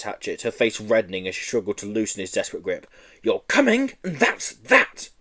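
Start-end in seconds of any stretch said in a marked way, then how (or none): 3.2-5.1 high, slow, final teacher voice; firm teacher. Acted. Teacher to clinging Joe, high slow and final. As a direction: lay down the law, spacing it out.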